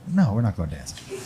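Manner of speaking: deadpan low impression of the older woman; deep mock woman voice. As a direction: drop way down for her flat no. Woman's flat refusal, acted quote.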